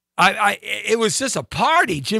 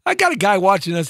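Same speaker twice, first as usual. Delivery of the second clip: ordinary delivery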